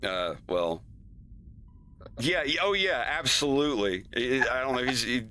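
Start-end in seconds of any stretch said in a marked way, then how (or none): none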